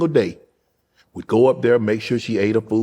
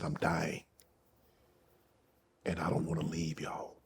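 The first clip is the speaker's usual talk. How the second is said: dying grandmother, hushed; 4 semitones lower, 16 dB quieter than usual. Retold or imitated. Retelling grandmother's words to his sister, very soft and low.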